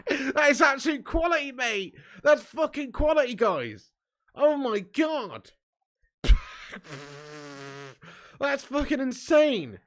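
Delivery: high squealing laugh, words slowed and stretched; hysterical laughter. Real, not acted. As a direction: crack up completely, can barely speak.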